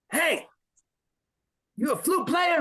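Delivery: high mock shouting drill sergeant; sergeant's voice. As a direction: do the sergeant as best you can remember. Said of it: "in the best voice I can do or remember".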